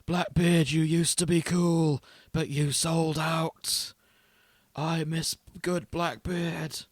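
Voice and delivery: imitating a raspy Yorkshire pirate, slow and drawn out; raspy Yorkshire impression. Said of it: "this raspy Yorkshire thing going on".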